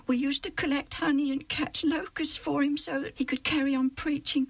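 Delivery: put-on high, eager young boy's voice. Alf telling Joe how they loved John, high and eager. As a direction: play an excited boy defending his hero.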